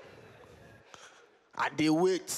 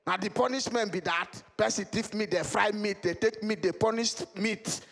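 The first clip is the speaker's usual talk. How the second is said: usual speaking voice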